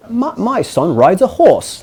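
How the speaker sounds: a deliberately terrible impression of a posh British dad; bad British accent. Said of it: "That was a terrible accent".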